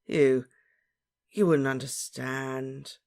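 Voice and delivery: slow, drawn-out lament; sighing. A narrator's reading. Lovesick Ron to puzzled friend, sighing. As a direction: sigh it out slowly. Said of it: "Ron sighed again".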